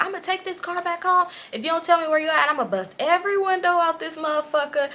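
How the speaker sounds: high-pitched mock threats; ranting girlfriend. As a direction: imitate an angry girlfriend listing threats. Voicing angry girlfriend's threats.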